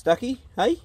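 high, rising pet-talk question; high coaxing call. Real, not acted. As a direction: sing the name up high to the duck. Coaxing the duck.

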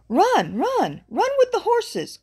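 high, urgent, loud call. A narrator's reading. Crow flying over the burro urges him on, calling high.

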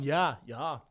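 low Wisconsin yeah-yeah on the phone; mock Wisconsin accent. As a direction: imitate a Wisconsin local picking up. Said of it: "They answer the phone".

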